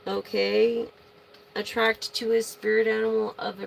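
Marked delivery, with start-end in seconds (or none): none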